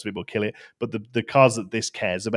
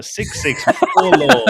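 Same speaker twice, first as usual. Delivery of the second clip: gleeful; 8 dB louder, slower than usual. Real, not acted. Excited at big card stats.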